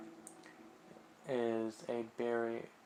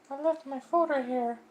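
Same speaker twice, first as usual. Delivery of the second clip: put-on much higher, small realizing girl's voice; a high voice that catches itself; high girlish murmur of realization; Sayori's voice; 9 dB louder than usual. Voicing Sayori.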